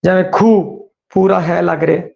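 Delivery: normal talking voice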